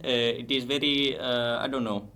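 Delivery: put-on Indian accent for a demo. Shown as a demonstration. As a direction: do a scammer-like Indian English accent. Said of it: "would you get an Indian accent".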